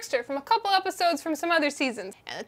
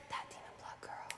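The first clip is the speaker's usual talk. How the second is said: very soft, voiceless tag line; breathy whisper; 23 dB quieter than usual. Acted. Sam craving demon blood, breathy whisper. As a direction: whisper it to close the song. End of sung parody.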